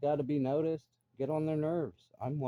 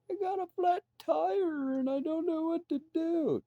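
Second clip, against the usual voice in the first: much higher, slow, put-on whimpering voice; mock whiny crier. Mocking a man crying over his flat tire, whiny high voice. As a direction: play the helpless guy about to break down.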